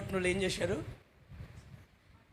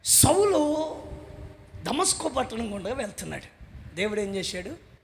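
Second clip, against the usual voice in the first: ordinary talk, nothing marked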